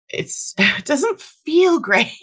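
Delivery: laughing through the words, slowed. Real, not acted. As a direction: chuckle while saying it. Joking about tin foil palette.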